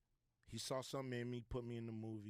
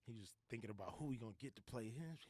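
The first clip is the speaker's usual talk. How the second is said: ordinary delivery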